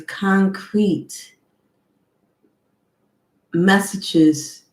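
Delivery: normal talking voice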